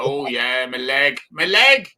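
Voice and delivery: high mock old-lady grumble, rising at the end. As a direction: moan about the leg like a pensioner. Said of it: "And they go".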